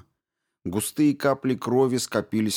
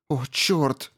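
gasp; 6 semitones higher than usual. A narrator's reading. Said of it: "ахнула Рао".

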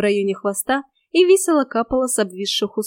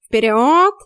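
loud command from a cat coach; punchy call to charge; rallying shout; 6 dB louder than usual. A narrator's reading. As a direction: urge them onward loudly. Cat urges on the narrator.

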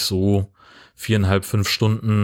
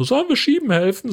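high-pitched eager offer. Retold or imitated. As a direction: pitch it up high, overeager helper. Campers offering help, quoted.

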